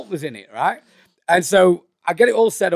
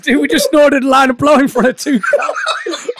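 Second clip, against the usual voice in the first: high, loud and barely holding back laughter; laughing mock panic; 9 semitones higher, 5 dB louder than usual. Friend realizing two cops watched, laughing mock panic. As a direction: retell the friend's panic while cracking up. Retold panic, teller cracking up.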